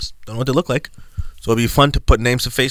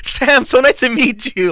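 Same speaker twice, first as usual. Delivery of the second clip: much higher, slow, sugary office greeting; high corporate voice; 13 semitones higher, 5 dB louder, slower than usual. Shown as a demonstration. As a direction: lay on the fake politeness. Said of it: "my voice gets dumb high".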